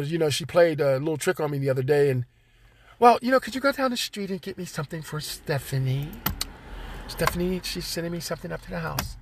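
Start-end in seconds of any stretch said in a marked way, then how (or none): 3.0-9.2 soft, sweet asking voice, fading quiet; stepmother voice. Retold or imitated. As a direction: sweetly ask a favour, then trail off.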